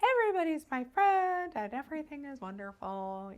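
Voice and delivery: hammed-up everyone-loves-everyone gush; mock-blissful, pitched well up; gushing over-friendliness. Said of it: "to not be like".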